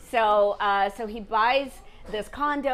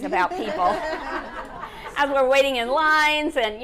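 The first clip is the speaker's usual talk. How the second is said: laughing husband quote; 6 semitones higher, 5 dB louder than usual. Retold or imitated. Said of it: "He said".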